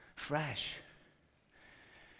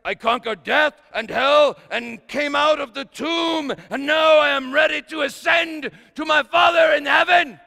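louder and higher, a hammed-up Jesus pleading to be let go; mock shout; 6 semitones higher, 6 dB louder than usual. Jesus joking, pushing Mary away.

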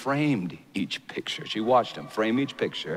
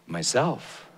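ordinary talk, nothing marked

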